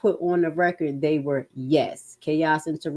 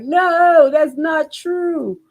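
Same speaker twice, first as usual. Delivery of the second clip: mock denial; 10 semitones higher, 6 dB louder, much slower than usual. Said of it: "They were like".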